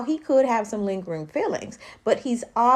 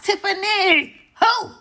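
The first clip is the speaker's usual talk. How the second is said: high, loud disbelieving "who?"; incredulous; 12 semitones higher, 6 dB louder, slower than usual. Real, not acted. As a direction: scoff it, pitched way up in disbelief. Incredulous jab at Tiffany.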